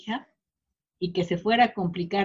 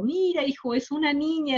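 higher, happy announcing voice; delighted announcement; 7 semitones higher than usual. Retold or imitated. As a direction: share the news with joy. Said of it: "mi suegra contentísima dijo". (suegra feliz anunciando a su hijo, voz más aguda)

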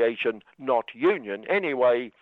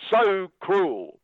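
mock tabloid outrage; 6 semitones higher than usual. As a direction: ham up the tabloid's shock. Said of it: "P one screaming".

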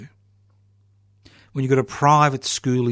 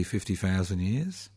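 ordinary delivery

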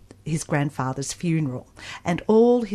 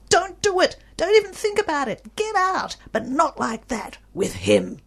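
high-pitched urgent warning cry; mock yell; 10 semitones higher, 4 dB louder than usual. Reader yelling at the young grandmother in the story, high urgent mock yell. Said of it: "we all want to yell at that young grandmother".

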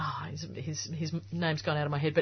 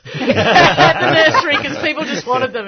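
laughing; 9 semitones higher, 14 dB louder, slower than usual. Real, not acted. Laughing about selling azaleas.

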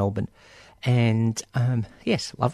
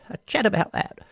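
put-on playful English accent, pitched higher; 8 semitones higher than usual. Host repeating a slogan, in an English accent. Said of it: "channeling English accents".

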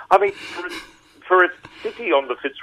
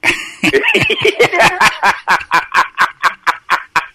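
hearty burst of laughter; loud laugh; 12 semitones higher, 6 dB louder than usual. Real, not acted. Laughing hard at the host's joke about Queenslanders leaving. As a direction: let out a big real laugh.

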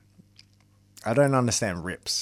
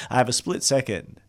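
usual speaking voice

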